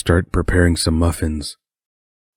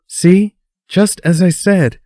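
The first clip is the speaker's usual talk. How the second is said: very high, louder gleeful exclamation; amused; 13 semitones higher, 5 dB louder, slower than usual. A narrator's reading. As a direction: crow it, tickled to be proven right. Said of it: "Sue laughs".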